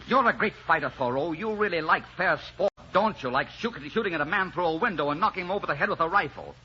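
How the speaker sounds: high, biting sarcasm; scornful taunting. Acted. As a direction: goad the enemy with mock praise. Goading the bandit leader to fight.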